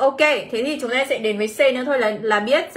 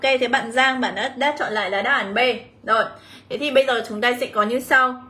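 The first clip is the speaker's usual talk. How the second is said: ordinary talk, nothing marked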